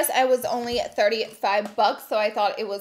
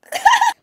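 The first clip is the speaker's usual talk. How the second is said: loud high-pitched burst of laughter; 14 dB louder than usual. Real, not acted. Reading her friend's thank-you note, a loud high laugh. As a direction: let a squeaky laugh burst out.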